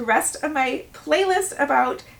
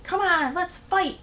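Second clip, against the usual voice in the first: higher-pitched challenge, not truly screamed; mock scream; 6 semitones higher, slower than usual. Angry woman outside the locked office door, mock screaming. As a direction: imitate someone screaming for a fight, without the volume. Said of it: "she was out there screaming".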